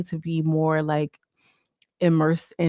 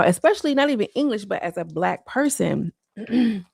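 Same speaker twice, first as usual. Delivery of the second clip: usual speaking voice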